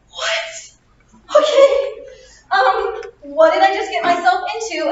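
high, loud, laughing what; a student stunned by rocket science, shouting high and laughing; shocked disbelief. Retold or imitated. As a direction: yelp in startled disbelief, laughing. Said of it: "And I was like".

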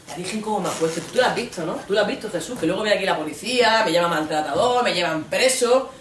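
ordinary talk, nothing marked

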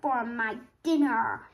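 loud, slow troll threat; menacing, booming storybook monster; growling troll. A narrator's reading. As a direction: growl it out big and drawn-out. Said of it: "growled the troll".

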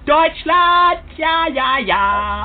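loud, high German cheering; boisterous mock German. Insisting he is German to a doubter, in a loud high chant. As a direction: belt it out like a rowdy German chant.

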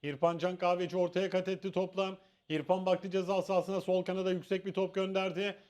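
steady live match commentary; play-by-play commentary. Acted. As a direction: call the build-up play by play. (maç anlatımı)

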